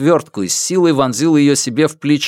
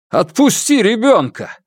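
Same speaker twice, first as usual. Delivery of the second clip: pleading shout; 11 semitones higher than usual. Acted. Father demands child's release.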